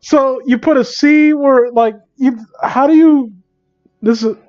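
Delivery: high-pitched incredulous stammer; baffled disbelief. Real, not acted. Reader puzzles over a misspelling, incredulous.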